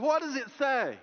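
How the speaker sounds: high, loud prodding question; amused retort. Retold or imitated. Old shepherd quizzing the student, amused prodding. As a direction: tease him with the question. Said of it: "old gentleman started laughing".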